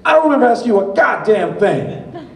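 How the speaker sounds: loud, high-pitched furious hitman impression; Samuel L. Jackson. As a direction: roar it at the man who cut in. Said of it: "and he goes".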